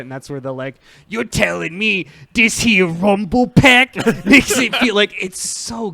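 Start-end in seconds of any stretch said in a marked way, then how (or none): 1.1-5.9 loud, slow, high mock Brooklyn accent. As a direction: ham up a bad Brooklyn accent. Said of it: "shitty Brooklyn accent".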